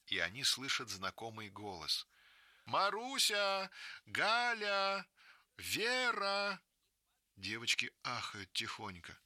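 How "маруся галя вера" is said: high, slow calls into the dark; teacher searching the forest for the girls; calling out. Acted. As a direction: call each name across the clearing.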